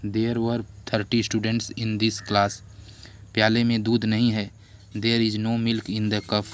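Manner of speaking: teacher dictating to the class, loud and slow; classroom dictation. Shown as a demonstration. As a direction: read each sentence out so the class can write it.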